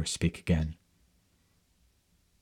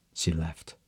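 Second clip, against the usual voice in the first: ordinary delivery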